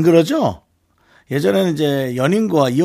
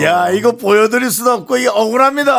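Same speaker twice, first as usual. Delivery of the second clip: loud, high plea of innocence; hammed-up wronged outcry; mock aggrieved; 7 semitones higher, 5 dB louder than usual. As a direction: protest like you're wrongly accused. Protests being unfairly accused.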